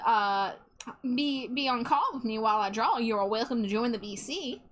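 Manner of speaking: ordinary delivery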